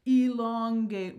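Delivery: "elongate" said slowly and lengthened; drawn out. Shown as a demonstration. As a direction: stretch "elongate" out syllable by syllable. Playfully acts out elongating.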